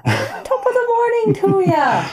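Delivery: put-on stage Irish accent. Friend joins the Irish impression.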